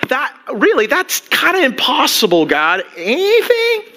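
high, incredulous whining at God; mock complaint to God. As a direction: pitch it up, disbelieving "Anything?".

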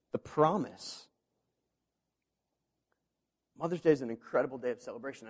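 ordinary talk

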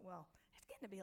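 usual speaking voice

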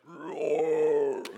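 drawn-out growling roar; imitated gator roar. Gators closing in as dreamer wakes, imitated roar. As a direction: roar like a lurking alligator. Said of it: "these gators are just like".

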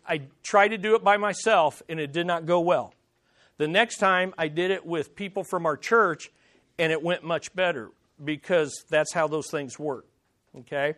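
normal talking voice

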